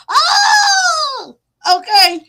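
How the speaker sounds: imitating her high, loud holler; mock holler. Commentator mimicking the woman hollering at the landlord, loud and high. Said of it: "That's how she hollered up in there".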